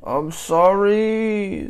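high, loud, long-drawn sorry; child's wailed apology. A narrator's reading. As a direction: whine it out like a child in trouble. Child's exaggerated apology in story.

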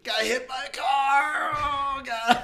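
loud high slow pained shout; hurt shout. Retold or imitated. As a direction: gasp it out like you just got hit. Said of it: "He's just like".

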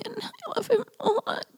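real tears rising as she talks about him; choked up. Real, not acted. Fighting tears while praising her best friend. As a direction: voice catching high and soft, close to tears.

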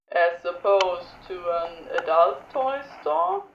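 low slow tease; smirking low drawl; Crowley voice. Acted.

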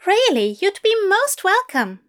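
high, eager English teacher; bright, keen invitation from a fussy gentleman. A narrator's reading. Fell voice again.

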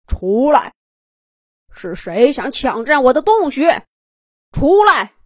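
loud, booming bear demand; muffled bear roar. A narrator's reading. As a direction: roar like an angry bear guarding its den. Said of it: "嗡声嗡气地吼道". (棕熊瓮声瓮气地怒吼)

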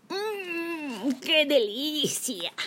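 slow, hushed hungry wolf; greedy wolf. A narrator's reading. Wolf savours the food.

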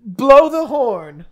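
drawn-out loud call; slow loud command. Acted. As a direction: boom it out slowly like a herald. Hyping the battle royale.

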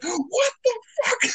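very high, drawn-out mock disbelief; retold shock. Moviegoer re-enacting his reaction to the twist, high shocked squeal. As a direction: squeak it out slowly, imitating stunned shock.

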